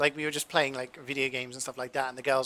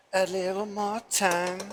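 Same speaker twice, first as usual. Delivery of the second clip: higher, slow sing-song plea; hopeful repeat; 6 semitones higher, 5 dB louder, slower than usual. Pretend. Telling the thickening dish to give it a little more time, slow and hopeful. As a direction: coax it along, drawn out. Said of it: "I'm gonna keep saying that".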